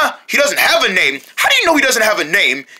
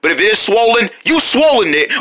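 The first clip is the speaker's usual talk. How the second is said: ordinary talk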